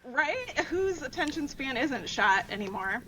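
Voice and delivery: ordinary talk, nothing marked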